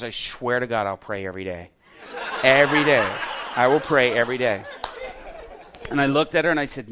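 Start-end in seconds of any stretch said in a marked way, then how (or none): 0.0-4.6 imitating a tearful, laughing vow; half-laughing, half-crying oath; tearful promise. Retelling the grandmother's tearful vow to pray, mock tearful. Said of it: "tears streaming out".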